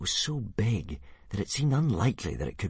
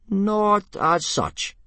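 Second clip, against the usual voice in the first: reluctant admission; 14 semitones higher, 5 dB louder, much slower than usual. A narrator's reading. Gobber sheepishly admits.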